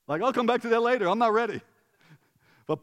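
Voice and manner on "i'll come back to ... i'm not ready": higher, louder mock reluctant brush-off; overwhelmed. Reader putting off an all-caps message, mock overwhelmed. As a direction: push the shouty message away for later. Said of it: "I'm like".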